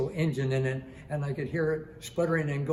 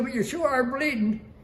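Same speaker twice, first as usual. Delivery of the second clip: high-pitched imitated exclamation; re-enacted exclaimed retort; 9 semitones higher, 4 dB louder than usual. Shipmate notices crewman's bleeding head, high retold exclamation. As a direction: point it out in a raised, high voice.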